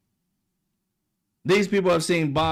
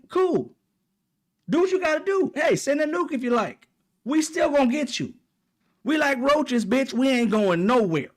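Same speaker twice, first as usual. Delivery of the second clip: much higher, cocky taunting voice; defiant Iran voice; 6 semitones higher than usual. Retold or imitated. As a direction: taunt America like you can't be touched. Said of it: "Iran said".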